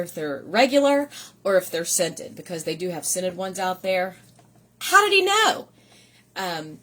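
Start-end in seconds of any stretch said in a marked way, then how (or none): 4.8-5.7 much higher and louder in delight; amazed. Real, not acted. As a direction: marvel at the gift. Amazed by the flare pins gift.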